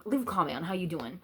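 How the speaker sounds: ordinary delivery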